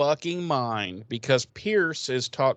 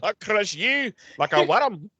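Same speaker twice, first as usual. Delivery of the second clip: higher, mock-menacing Scottish voice; raised Scottish caricature voice; put-on Scottish accent; 7 semitones higher, 4 dB louder than usual. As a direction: threaten in a hammed-up Scottish accent. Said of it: "That was Scottish".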